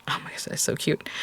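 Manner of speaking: soft whisper of delight. Real, not acted. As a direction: whisper it, charmed by the card. Crafter admiring finished Pip.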